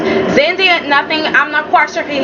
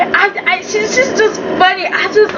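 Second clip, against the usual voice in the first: ordinary talk, nothing marked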